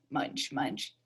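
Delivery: low breathy munching sounds; munch like a bear chewing; munching noise. A narrator's reading. Bear mother eating.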